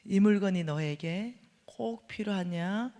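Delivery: slow, weighty voice of conscience; each word laid out slowly as if from above; slow searching question. Retold or imitated. God's inner voice asking if she truly needs it, slow and weighty.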